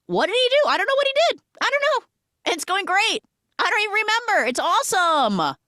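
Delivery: high, loud, frantic mock babble; manic mimicry. Man mocking forgetting beside sister's trauma, high and manic. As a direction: squeal it out in pretend cluelessness.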